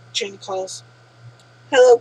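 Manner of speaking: usual speaking voice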